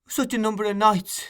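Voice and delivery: put-on girl gently reproaching Sykes, high and tender. A narrator's reading. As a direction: soften into her tenderness, pitched high. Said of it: "with a touch of woman's tenderness".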